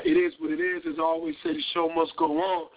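ordinary delivery

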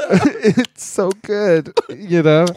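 high, slow, laughing through fake sobs; mock sob while laughing; laughing crying imitation. Sister crying over bacon, imitated with laughing sobs. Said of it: "she's crying and eating the bacon".

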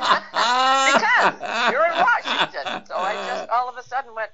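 laughing. Real, not acted. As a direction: keep giggling as you explain. Answers while laughing.

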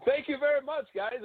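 a sly, hammed-up thank you to the umpire; mock polite thanks. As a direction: play it overly sweet and polite, tongue in cheek. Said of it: "And I said".

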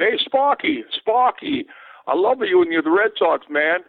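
mock Boston accent, high and calling out; Boston fan accent. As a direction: call out like a Boston heckler in the bleachers. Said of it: "you could tell by the accent".

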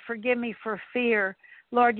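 ordinary talk

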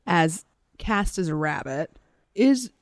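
ordinary delivery, nothing marked

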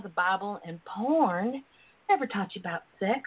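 usual speaking voice

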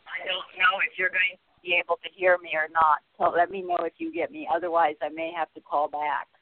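distant tinny phone line; tin can phone. Real, not acted. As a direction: caller sounding far away in a metal tank. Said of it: "in a tin can".

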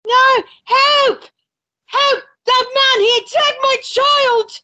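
high, desperate cries for help; mother calls for help after her child is hurt; shouting for help. Acted. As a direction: scream for help over the crowd.

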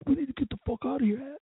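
high, urgent mock alarm; hammed-up nervous urging; mock panic. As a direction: imitate a buddy spooked and wanting out. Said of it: "And Bane's like".

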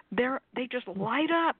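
ordinary delivery

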